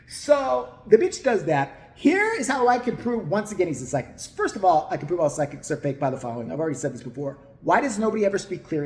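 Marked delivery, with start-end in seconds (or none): none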